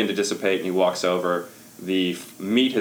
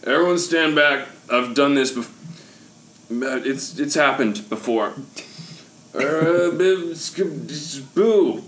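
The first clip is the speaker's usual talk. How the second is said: put-on overbright kids party host; 6 semitones higher, slower than usual. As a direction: stumble in then sell the magic. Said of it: "and be like".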